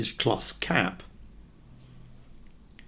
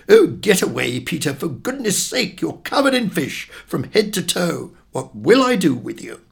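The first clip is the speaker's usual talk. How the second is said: mother's higher-pitched scold; raised, exasperated motherly voice; 6 semitones higher, 4 dB louder than usual. Acted. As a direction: scold a child in fussy exasperation. Mother scolds child over fishy jumper.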